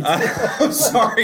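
laughing apology. Real, not acted. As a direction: apologize through a real laugh. Laughs after stealing the point.

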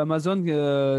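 ordinary delivery

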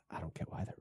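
barely audible whisper; hushed, trailing-off trial run; whispered to himself. Real, not acted. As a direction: whisper the line under your breath. Muttered rehearsal of the president's line.

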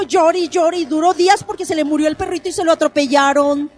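loud, high insistent objection; objecting listener. Pretend. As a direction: argue that it's nonsense. Audience member objecting, acted.

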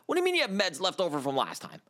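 high, fast disbelief; can't believe what they said; disbelieving. Real, not acted. Vet reacts in disbelief.

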